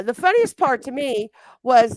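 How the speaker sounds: ordinary delivery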